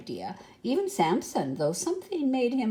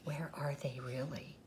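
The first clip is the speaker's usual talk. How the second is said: soft, low conspiratorial whisper; 5 semitones lower, 12 dB quieter than usual. Acted. Storyteller whispers the truth about the park to the children. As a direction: let the children in on the secret.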